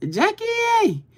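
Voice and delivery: sung-out high call of the name; delighted shout-out to a friend; excited call. Real, not acted. Calling out a viewer's name.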